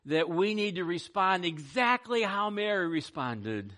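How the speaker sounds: loud, slowed, emphatic pulpit push; fervent preaching. Acted. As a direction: drive the point home to the congregation. Urges the church to respond.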